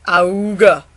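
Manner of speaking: nasal honking squawk; bagpipe honk. Acted. Bagpipe answering the turtle's squeeze, honking squawk. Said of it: "And the bagpipe said".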